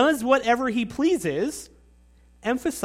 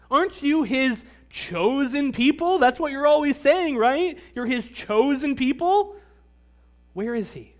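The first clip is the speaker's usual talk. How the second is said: higher, mock-incredulous questioning; sarcastic taunt; 5 semitones higher than usual. Outsiders mocking God's chosen people, sarcastic taunt. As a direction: throw their boast back at them, mocking. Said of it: "almost hear the sneer of sarcasm".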